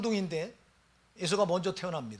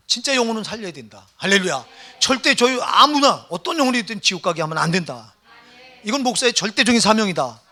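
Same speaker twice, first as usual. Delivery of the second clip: fervent preaching; 2 semitones higher, 9 dB louder than usual. Acted. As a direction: drive the point home to the congregation. Preaching at full force. (열정적인 설교)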